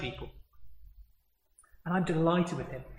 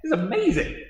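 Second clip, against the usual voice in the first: higher, bright-eyed amazement, imitating Peter; awestruck Peter; 7 semitones higher than usual. Peter on the mountain, awestruck at the sight, mock wonder. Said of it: "You're like".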